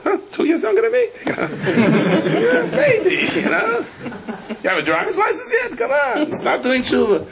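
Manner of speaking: put-on very high, laughing old woman voice; teasing old lady. Hundred-year-old lady teasing her friend, high and laughing. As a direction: cackle and tease her like a youngster.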